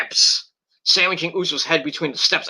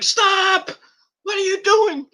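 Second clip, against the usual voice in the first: very high-pitched mock alarm; mock frantic official; slower than usual. Mimicking officials rushing in to intervene, hammed-up frantic plea. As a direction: imitate officials crying out in a much higher voice.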